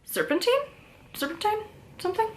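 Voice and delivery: "Serpentine" tried out as high, rising questions; unsure pronunciation. Real, not acted. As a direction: guess at "Serpentine", pitch lifted, asking each time. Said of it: "depending on how you pronounce those words".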